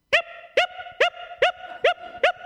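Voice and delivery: very high, loud squeaky yips; little dog yipping. Retold or imitated. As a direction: imitate a Pomeranian's sharp repeated barks. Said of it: "there's a little Pomeranian dog".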